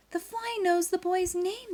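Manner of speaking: high astonished voice; amazed judges. Acted. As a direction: marvel at it.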